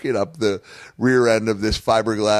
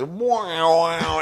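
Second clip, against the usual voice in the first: a high, rattled wow of impact; voicing his body bouncing off the table; body going wow; 7 semitones higher than usual. Retelling the crash, a high startled wow. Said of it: "my whole body just goes".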